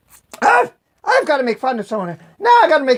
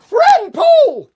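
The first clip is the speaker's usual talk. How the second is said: high, loud exclamation of recognition; acted astonished greeting; surprised recognition; 12 semitones higher, 4 dB louder than usual. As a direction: burst out high on spotting someone familiar. Santa suddenly recognizes the disguised politician.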